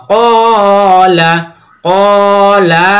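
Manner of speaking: "কলা" slowly chanted, long "ক"; drawn-out first syllable. Shown as a demonstration. Teacher demonstrates the lengthened "ক" in "কলা". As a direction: hold the "ক" of "কলা" on one note. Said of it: "শুধুমাত্র কফ টেনে পড়বো".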